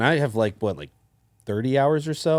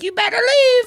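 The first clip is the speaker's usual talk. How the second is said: put-on far higher, childlike threat; threatening kid; 4 dB louder than usual. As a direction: warn the stranger off like a tough kid. Tiefling kid threatens player.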